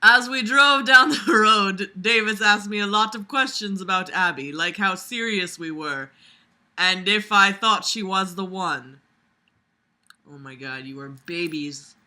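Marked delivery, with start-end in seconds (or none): 0.0-9.0 loud, projected read-aloud; story narration. A narrator's reading. Vlogger reading a story aloud to listeners, loud narration. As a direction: read it out like an audiobook, punchy.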